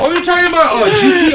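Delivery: high-pitched excited ribbing; joking with friend about his bike, high excited teasing. Real, not acted. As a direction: squeal it out, laughing at him.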